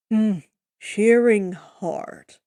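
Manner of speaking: a hint of a lumbering giant, each word dragged out; slow Cyclops voice. The Cyclops agreeing with Odysseus about shearing, slow heavy voice. Cyclops speaks in few words.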